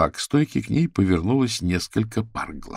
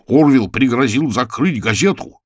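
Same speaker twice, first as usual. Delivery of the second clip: shouting editor; 5 semitones higher, 6 dB louder than usual. Acted.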